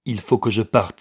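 usual speaking voice

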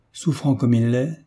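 usual speaking voice

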